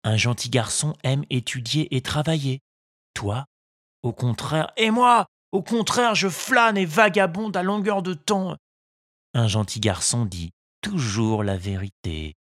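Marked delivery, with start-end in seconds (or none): 3.1-4.7 the fairy says au contraire softly. A narrator's reading. 4.8-8.6 put-on high boyish voice, boasting au contraire; cheeky puppet boy. A narrator's reading.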